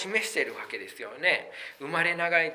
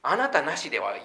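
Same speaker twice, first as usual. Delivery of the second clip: usual speaking voice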